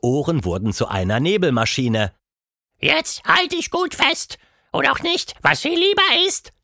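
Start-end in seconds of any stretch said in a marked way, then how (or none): 2.8-6.5 sehr hoch, übermütig und langsam; rufendes Quatschmonster. A narrator's reading. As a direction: verschmitzt warnen vor der Abfahrt. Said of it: "rief er". (Quatschmonster ruft Tilda übermütig zu)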